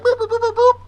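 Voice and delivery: very high, rapid-fire boop noises; imitated boops. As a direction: squeak out a row of button beeps. Imitates buttons pressed in back.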